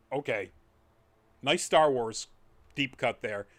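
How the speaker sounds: ordinary delivery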